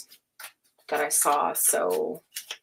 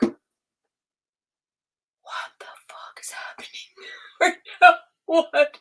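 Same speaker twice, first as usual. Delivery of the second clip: higher, whispered, breaking into laughter; real overwhelmed laughing whisper; laughing disbelief; 8 semitones higher than usual. Real, not acted.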